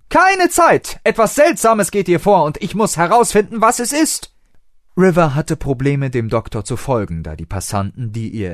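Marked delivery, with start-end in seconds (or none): 0.1-4.3 high, loud, hurried eagerness; excited. A narrator's reading. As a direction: blurt it over his shoulder on the move. Doctor rushing off excited. (aufgeregt im Gehen)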